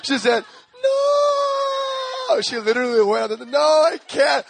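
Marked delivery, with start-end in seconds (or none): 0.8-2.4 sudden high shouted no, far from his own voice. Retold or imitated. Fiancée refusing him, a high shouted no. 3.5-4.4 1 panicked refusal. Retold or imitated. Fiancée panicking at proposal.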